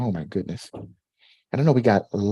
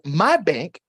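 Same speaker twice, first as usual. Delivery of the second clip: reading Connie's comment; 9 semitones higher than usual. Retold or imitated. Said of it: "Connie says".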